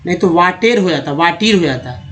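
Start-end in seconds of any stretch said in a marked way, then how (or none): 0.3-0.8 वाटेर with a long "ay" in the last syllable; misread vowel. Shown as a demonstration. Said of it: "ई पे ध्यान नहीं देंगे". 1.1-1.6 वाटीर with a long "ee" in the last syllable; misread vowel. Shown as a demonstration. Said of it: "ई पे ध्यान नहीं देंगे".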